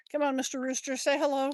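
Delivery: normal talking voice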